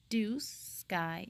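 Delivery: ordinary delivery, nothing marked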